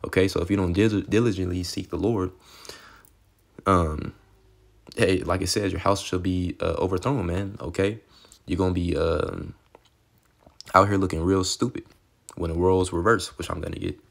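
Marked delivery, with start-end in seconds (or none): none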